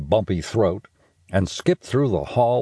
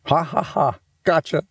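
put-on a higher, teasing kid's laugh into gotcha; mock laughing gotcha; 8 semitones higher than usual. A narrator's reading. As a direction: crow it like a prankster kid. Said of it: "Stink cried".

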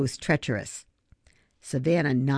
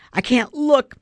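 moaning; 8 semitones higher, 5 dB louder than usual. A narrator's reading. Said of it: "moaned Ben".

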